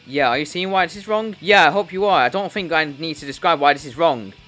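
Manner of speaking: ordinary talk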